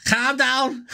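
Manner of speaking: high, amused Scouse mimicry; Scouse catchphrase. Echoing the cartoon teacher for viewers, high Scouse impression. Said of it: "is the epitome of Scousers".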